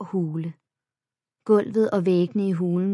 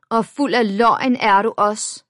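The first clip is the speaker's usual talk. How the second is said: accusing; 4 semitones higher, 7 dB louder than usual. A narrator's reading. Bully accuses narrator.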